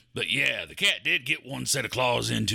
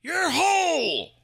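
furious shout; 4 dB louder, much slower than usual. Acted. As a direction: yell it high and hard. Snaps at heckling co-host.

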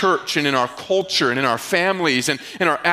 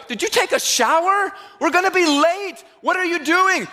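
much higher, incredulous and impatient; mock exasperation at the late friend; exasperated; 9 semitones higher than usual. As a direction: driver scolding the friend with wet hair, exasperated. Driver scolds late dripping friend.

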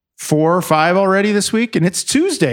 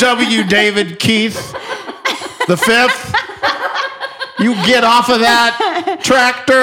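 mock scolding parent yell; hollering at a kid, hammed-up; yelling child's full name; 7 semitones higher, slower than usual.

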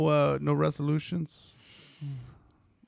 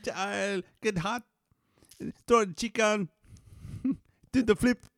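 put-on a light Jamaican accent, higher pitch; 8 semitones higher than usual. Mimicking the restaurant guy's cooking talk, light Jamaican accent. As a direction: lightly imitate the Jamaican cook. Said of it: "the Jamaican guy from the restaurant".

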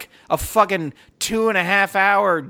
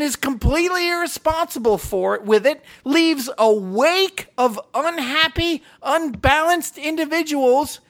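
higher, fired-up complaint; heated rant; 7 semitones higher than usual. Retold or imitated. Ranting to his wife about Tony Stark, worked up. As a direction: keep the rant rolling. Movie rant keeps building.

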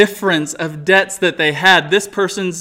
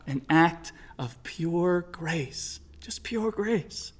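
soft and slow, letting the words land; hushed awe; 9 dB quieter, slower than usual. Real, not acted. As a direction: hush down and savour pure grace. Preacher stresses grace softly.

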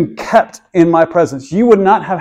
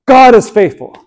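raised, louder joyful cry of faith; joyful declaration; 6 semitones higher, 5 dB louder than usual. Retold or imitated. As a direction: proclaim it like the whole crowd answering back. People declare God's faithfulness.

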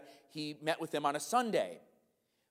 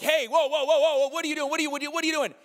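high, loud alarmed yelling at a car thief; panicked owner catching a thief; startled shout; 10 semitones higher, 5 dB louder, much faster than usual. Pretend. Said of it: "And you're like".

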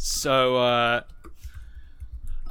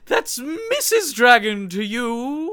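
haughty correction, raised pitch; indignant dragon; 8 semitones higher, slower than usual. Acted. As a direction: correct him with prim offense.

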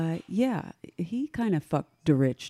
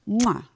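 loud kiss sound; 4 semitones higher, 6 dB louder than usual. Acted. Kissing the microphone.

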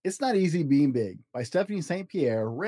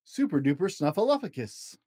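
slow, lilting picture-book read with long page-turn pauses; reading to children; 4 semitones higher, slower than usual. Acted. Dad reading a picture book to his child, slow storybook lilt. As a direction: read it like a bedtime storybook for a little one.

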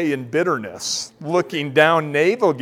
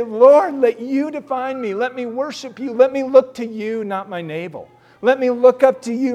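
raised, higher pleading prayer to the Lord; fervent prayer; 7 semitones higher, 4 dB louder than usual. Acted. Preacher praying out loud to the congregation, fervent and raised. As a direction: cry out to God from the pulpit.

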